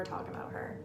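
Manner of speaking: ironic whispered aside; joking whisper. Real, not acted. Joking to her co-host about Jen, whispered. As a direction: whisper it as a mock confession. Joking denial after gushing.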